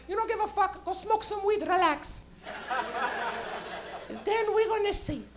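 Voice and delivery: high, challenging mother voice; imitated mom. Mom daring her angry son, high-voiced. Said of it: "She'd be like".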